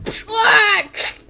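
high, loud mock yell; spluttered cry of impact; crash cry. Figure tripping over a body, loud crash cry. Figure trips and breaks neck.

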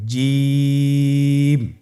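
জিম held out very long on one pitch; long drawn jim. Shown as a demonstration. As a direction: draw জিম out slowly, three alif long. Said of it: "দেখুন আমি কিভাবে উচ্চারণ করছি". (জিম তিন আলিফ টেনে লম্বা করে)

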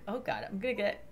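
ordinary talk

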